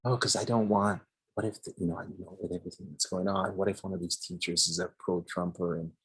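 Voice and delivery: softly, almost under the breath; hushed voice. Retold or imitated. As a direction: keep it low and secretive. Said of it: "Why are you talking so low?".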